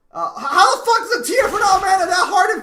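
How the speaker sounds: high, loud incredulous yell with a laugh; laughing rage, shouting high and loud; furious laughing shout. Real, not acted. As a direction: scream in disbelief at the teammate. Raging at teammate's mana.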